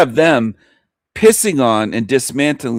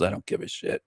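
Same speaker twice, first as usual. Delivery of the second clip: muttered aside; 5 semitones lower, 16 dB quieter, faster than usual. Real, not acted. Dismissive aside.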